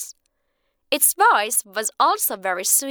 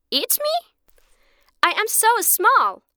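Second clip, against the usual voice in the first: high, incredulous little bird; amused bird voice; 11 semitones higher than usual. Acted. Bird laughs off the man's threat, high and incredulous. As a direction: scoff at the idea of being eaten.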